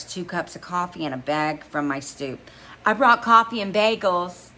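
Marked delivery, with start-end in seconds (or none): 2.8-4.5 high, bright and loud; beaming. A narrator's reading. Girlfriend beams offering him breakfast. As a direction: beam as you offer it.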